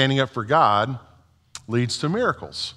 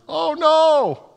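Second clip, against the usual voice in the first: mock alarm. A narrator's reading. Peter sinking in the water.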